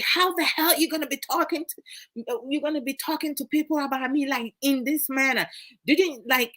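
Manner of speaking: high, rapid indignant rant; retold anger. Wife recalling how she used to confront Kay, retold indignant anger. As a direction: fire off the complaint in mock outrage. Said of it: "I will get angry".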